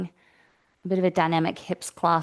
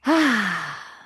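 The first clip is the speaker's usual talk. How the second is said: long, open exhaled sigh; settling sigh. Real, not acted. Yoga teacher getting grounded on the mat, audible sigh. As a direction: let out a big falling "hah" to settle. Settling in before class begins.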